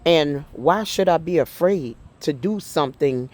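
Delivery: ordinary delivery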